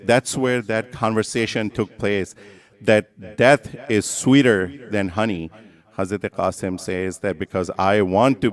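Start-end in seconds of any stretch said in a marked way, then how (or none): none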